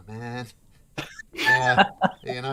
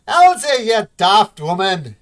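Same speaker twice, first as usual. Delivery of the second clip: put-on loud, higher old dwarf bellowing; yell across the room; 11 semitones higher, 14 dB louder than usual. Old brother yelling at his sister across the shop, loud. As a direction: shout her down from across the shop. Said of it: "you hear a yell from across the room".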